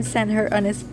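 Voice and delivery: ordinary talk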